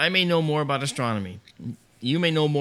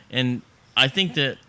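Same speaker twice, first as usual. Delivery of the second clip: normal talking voice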